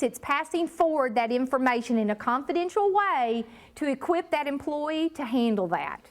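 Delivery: normal talking voice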